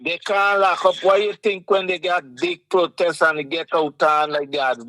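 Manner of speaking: ordinary talk, nothing marked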